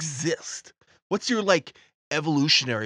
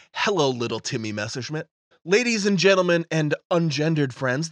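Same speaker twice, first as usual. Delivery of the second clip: ordinary delivery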